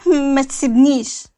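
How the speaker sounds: slow, mildly tearful appeal; understated tearful begging, drawn out; tearful plea. A narrator's reading. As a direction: plead slowly with a touch of tears. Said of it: "لما قالت له بدموع".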